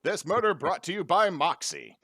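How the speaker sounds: loud, high sponsor read; old-timey ad announcer. Pretend. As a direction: sell it like a vintage radio spot. Mock radio ad sign-off.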